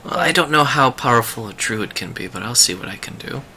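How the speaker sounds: soft, confidential in-character answer; hushed reply. Acted. Druid modestly answering Finbar's invitation, hushed. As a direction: keep it very quiet, just between two.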